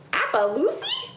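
put-on very high, puzzled child's question; surprised cry. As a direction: squeak it as a baffled kid. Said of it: "cried Em".

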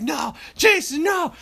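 high, slow shout of no; high desperate cry of no. Retold or imitated. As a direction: scream it as he's held back. Friend held back, screaming.

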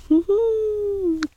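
a high, bright burst of relieved laughter. Real, not acted. Telling viewers she is finally pain-free, laughing with relief.

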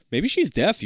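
ordinary talk, nothing marked

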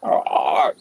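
low, voiceless croak; somber raven caw. Acted. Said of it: "this next call is a little bit more somber than usual".

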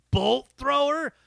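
drawn-out mocking band name. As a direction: say it with scorn.

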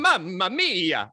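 slow, drawn-out Italian cartoon plumber exclamation; Mario. Praising the Italian teenager, mock Mario exclamation. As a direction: stretch it out like a video game plumber. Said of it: "as Chris Pratt would say as Mario".

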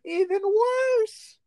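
cracking up and yelling in disbelief; laughing shout. Real, not acted. Friend shrieks with laughter at the confession, laughing shout.

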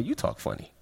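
hushed, breathy retort; whispered comeback. Retold or imitated. Boy answering classmates who mock his accent, whispered back. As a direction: throw it back under your breath. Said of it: "And I'm like".

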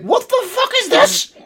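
very high, loud, laughing disbelief; hammed-up shriek of baffled outrage; outraged American reaction. American viewers reacting to English punditry, shrill mock bewilderment. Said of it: "the, the, the response from Americans".

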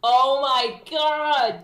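loud, high, stretched-out delight; big drawn-out fan excitement; thrilled. Real, not acted. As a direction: gush over it, drawing out each word. Thrilled at friend's Godzilla costume.

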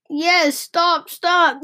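high, urgent pleading; begging. A narrator's reading. Passengers begging the driver to stop, pleading. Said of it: "the passengers begged".

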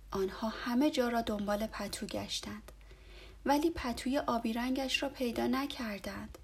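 ordinary delivery

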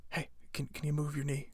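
mock whisper. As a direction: whisper it from a cramped trunk. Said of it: "They're like".

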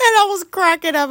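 high, loud laughter running into the words; real laugh while telling it. Real, not acted. Recalling friend's funny delivery.

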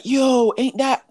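high, slow, drawn-out call; friend spotting them. Retold or imitated. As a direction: stretch it up high like you just spotted her. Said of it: "the man them are like".

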